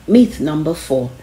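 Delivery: ordinary delivery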